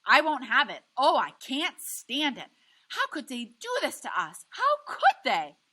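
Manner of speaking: wife pleading with her husband, yelling through tears; tearful yell rising to wailing. A narrator's reading. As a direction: cry out in despair, voice cracking. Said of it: "Graham yells".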